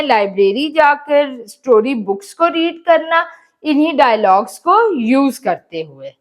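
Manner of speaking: ordinary talk, nothing marked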